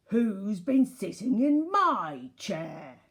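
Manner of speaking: very loud, slow gruff bear; gruff Daddy Bear. A narrator's reading. Daddy Bear looks at his chair, gruff roar. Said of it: "said in a gruff voice".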